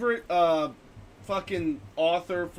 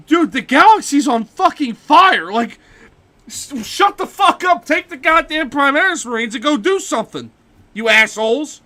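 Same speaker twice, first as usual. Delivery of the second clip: high, loud, hammed-up outburst; exasperated yell; 8 semitones higher, 10 dB louder than usual. Ultramarine berating his allies for ignoring a galaxy on fire, exasperated yell. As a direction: chew them out at full volume in mock fury.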